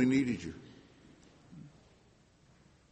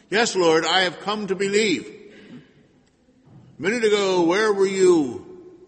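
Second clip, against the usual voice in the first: loud anguished reproach; 7 semitones higher, 6 dB louder than usual. Acted. Martha's answer and reproach.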